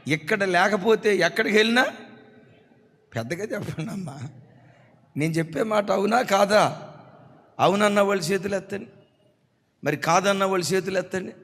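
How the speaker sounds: rally the congregation, calling out to them; preaching. Real, not acted. Preacher addresses congregation forcefully.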